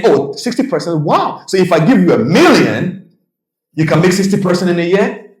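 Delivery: high, excited mock amazement; amazed millionaire. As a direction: act dazzled at the number.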